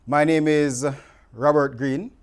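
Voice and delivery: ordinary talk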